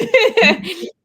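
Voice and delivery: real high laugh; loud high laugh. Real, not acted. Laughs at own question.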